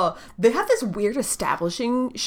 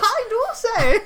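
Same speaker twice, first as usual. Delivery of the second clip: high, loud laughing toff; posh laughing gent; 13 semitones higher, 4 dB louder than usual. Pretend. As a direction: chuckle it like an old toff.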